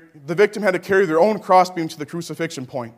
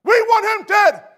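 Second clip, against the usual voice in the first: rising furious crowd shout, much higher and louder; yelling mob; 12 semitones higher, 4 dB louder than usual. Acted. Preacher voicing the mob demanding death, full shout. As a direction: scream it like the baying crowd. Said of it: "They yelled out".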